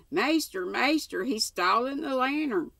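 narrated shout, pitched well up; old man's shout. A narrator's reading. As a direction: call out to the master as the old servant. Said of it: "shouted the ancient".

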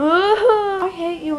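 loud, high, drawn-out whine; tearful mock outburst. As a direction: wail it slowly in hammed-up hurt. Robin's upset outburst at Indiana Jones.

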